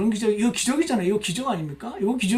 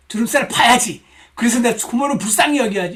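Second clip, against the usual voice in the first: higher, louder self-exhortation; hammed-up dutiful determination; mock resolve; 4 semitones higher, 6 dB louder than usual. Preacher parodying forced pity for aunt, mock resolve.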